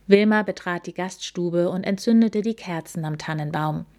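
ordinary delivery

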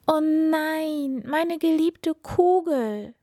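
high, drawn-out cry of dismay; wailing princess. A narrator's reading. Princess crying out over her golden ball lost in the well, high wail. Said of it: "rief die Prinzessin".

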